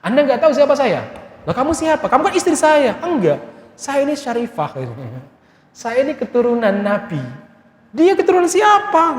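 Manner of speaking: arrogant exchange voiced high and put-on; the wife and husband arguing over her lineage, haughty and high; haughty high voices. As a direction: lord it over them with a high sneer.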